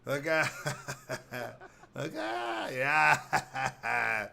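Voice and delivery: high, loud cackling laugh; mock laughing. Daycare worker laughs at crying kids, high and loud.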